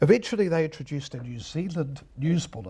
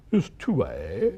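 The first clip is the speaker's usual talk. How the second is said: slow, formal announcer read; mock the stiff old announcer; slow plummy radio announcer, lightly imitated; 3 semitones lower than usual. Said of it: "the announcers used to speak that way".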